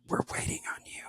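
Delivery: hushed unvoiced whisper. Secretary whispering that court is waiting, hushed. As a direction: breathe it urgently in a whisper. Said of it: "She goes".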